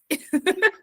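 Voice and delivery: quick light laugh; a brief laugh at her own joke; short laugh. Real, not acted. (risada curta e leve)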